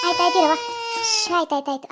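tearful words with a crying sound running through them. Acted. Mother crying to grandmother over her feverish baby. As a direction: let the tears break into the words.

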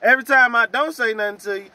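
usual speaking voice

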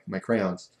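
ordinary delivery

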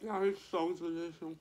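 talking while chewing; words muffled by food; mouth full. Real, not acted. Seen 0: Put food in mouth at -4; lips pursed, chewing while speaking.

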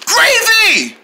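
high, hammed-up shout; mock alarm. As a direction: cry out for a falling friend. Friend falls out the window.

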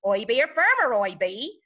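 nasal, twangy West Country farmer accent. Shown as a demonstration. Coach showing twang in English accents, West Country accent. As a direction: put on a broad West Country burr. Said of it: "the West Country accent, the kind of".

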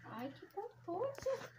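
high, soft, slow coo; soft baby talk. Acted. Woman as the dog licks her hand, soft high baby talk.

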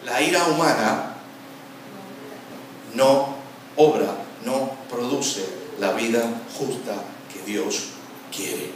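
word by word, with long pauses; slow deliberate repetition. Shown as a demonstration. Preacher driving the verse home to listeners, slow and deliberate. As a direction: spell the verse out slowly, phrase by phrase. Same verse repeated slowly for emphasis.

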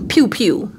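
mock gunshots. Imitating gunshots while telling story.

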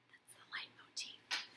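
breathy whispered aside to the class. Real, not acted. Teacher whispering a quick aside to the class.

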